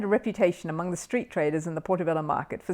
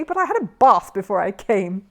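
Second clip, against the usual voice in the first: indignant; 7 dB louder than usual. A narrator's reading. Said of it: "he repeated hotly".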